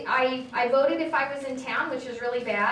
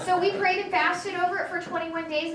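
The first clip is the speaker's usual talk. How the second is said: ordinary delivery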